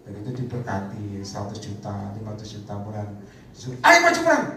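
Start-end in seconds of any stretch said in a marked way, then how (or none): 3.8-4.4 much higher and louder, charging call; rally the troops forward; rallying battle cry. Retold or imitated. Preacher voices the call to go to war, loud and high.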